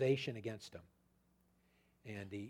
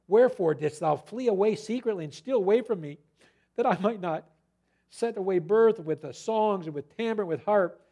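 rising, louder dramatic reading of Laban; scripture reading; 5 semitones higher, 6 dB louder than usual. Acted. Preacher reading Laban's accusation to the congregation, rising dramatic. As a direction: build the accusation higher and louder to the end.

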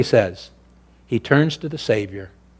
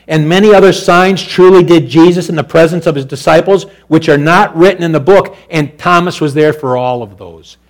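full-force preaching; 6 semitones higher, 12 dB louder than usual. Acted. Preacher thundering scripture to the congregation, full force. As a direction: preach it out to the back pews.

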